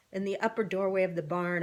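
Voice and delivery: usual speaking voice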